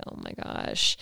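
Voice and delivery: breathy "Oh my gosh" under her breath; whispered thought. Retold or imitated. Whitney silently panicking at the tense table, whispered. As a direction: whisper it like you don't want anyone to hear.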